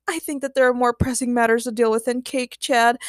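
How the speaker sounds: ordinary delivery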